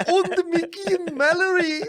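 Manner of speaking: squealing fan. Retold or imitated. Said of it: "You even go like".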